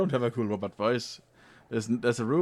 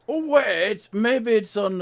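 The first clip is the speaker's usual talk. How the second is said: sudden realization; 12 semitones higher, 9 dB louder, slower than usual. Real, not acted. Sudden realization while searching.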